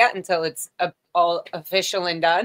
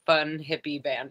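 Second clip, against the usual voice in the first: usual speaking voice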